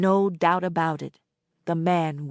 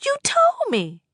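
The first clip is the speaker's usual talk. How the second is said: much higher voice; insistent girl; 4 dB louder than usual. A narrator's reading. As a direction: remind her sharply. Annette's voice.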